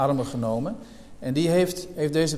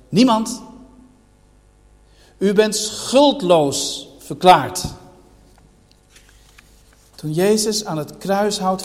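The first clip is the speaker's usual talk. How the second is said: raised, ringing pulpit voice, higher and louder; preaching at full force; 3 semitones higher, 6 dB louder, slower than usual. Acted. Preacher pressing the church with questions, full-force preaching. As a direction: hammer each rhetorical question home to the hall.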